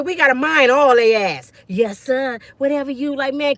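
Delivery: normal talking voice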